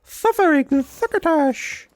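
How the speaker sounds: very high, slow cartoon character voice; Looney Tunes catchphrase. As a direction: squeak it out like a cartoon animal. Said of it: "what is that thing that he says".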